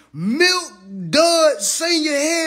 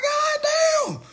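shocked yell. Retold or imitated. Reacting to Milk Dud's post, high shocked yell. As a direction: shout it in disbelief. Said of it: "I'm like".

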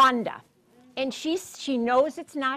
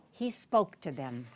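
plain reading voice

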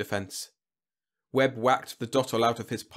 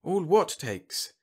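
slow, high-pitched helpless question; 8 semitones higher, slower than usual. A narrator's reading. Whitlow lost before the general, helpless. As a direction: ask it slowly, quite lost. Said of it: "said Whitlow helplessly".

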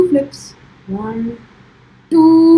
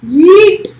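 normal talking voice

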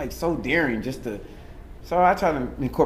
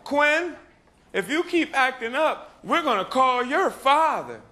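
scolding teacher; 13 semitones higher, slower than usual. Retold or imitated. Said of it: "my teachers used to say".

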